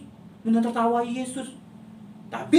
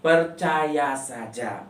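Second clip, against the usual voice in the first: slow recitation; 4 semitones lower, 5 dB louder, slower than usual. Shown as a demonstration. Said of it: "baca ayat hafalannya".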